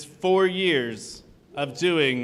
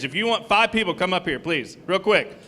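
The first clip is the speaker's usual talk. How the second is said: ordinary delivery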